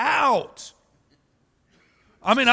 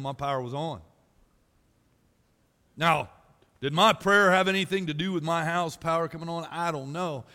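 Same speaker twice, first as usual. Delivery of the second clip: ordinary talk, nothing marked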